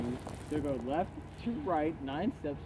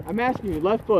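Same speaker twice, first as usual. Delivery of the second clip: loud angry insisting; pressing hard, fed up; full on mad; 4 semitones higher, 9 dB louder than usual. Real, not acted. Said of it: "[name] is getting full on mad".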